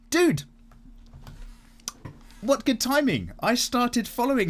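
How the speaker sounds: high, loud delighted outburst; thrilled surprise at the find; excited. Real, not acted. Delighted at the artist's work.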